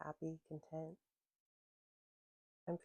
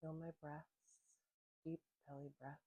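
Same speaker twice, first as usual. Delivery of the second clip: calm, unhurried voice with long pauses; gentle grounding-practice guide; guided meditation; 5 dB quieter than usual. Real, not acted. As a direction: ease listeners into their senses, letting phrases settle and fade.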